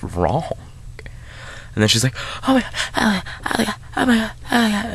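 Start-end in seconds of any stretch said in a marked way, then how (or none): 2.5-4.9 high-pitched, repeated panicky squeal; mock panic. Sister finding her brother dead, mock panic. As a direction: imitate a horrified girl in a thin-volumed high voice.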